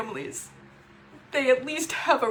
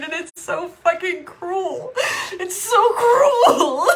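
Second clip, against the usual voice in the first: slow, high, louder wail breaking on cruel; sobbing anger; 5 semitones higher, 5 dB louder, slower than usual. Real, not acted. Sister's cruelty to mother, sobbing shout. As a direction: cry it out, stretching cruel.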